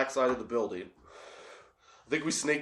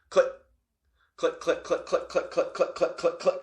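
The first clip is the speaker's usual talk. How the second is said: rapid clicking lock sounds; mouth-made click click of bolts; 3 semitones higher than usual. Retold or imitated. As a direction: click it out like locks turning one by one. Imitating many locks opening.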